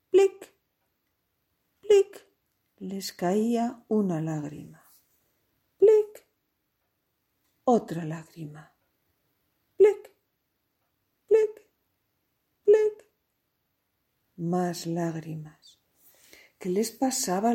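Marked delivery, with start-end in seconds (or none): 0.1-2.3 high, short, loud "plic"; tear-drop plink. A narrator's reading. Storyteller sounding giraffes' falling tears, high plinking drops. 5.8-6.3 high, short, loud "plic"; tear-drop plink. A narrator's reading. Storyteller sounding giraffes' falling tears, high plinking drops. 9.8-13.1 high, short, loud "plic"; tear-drop plink. A narrator's reading. Storyteller sounding giraffes' falling tears, high plinking drops.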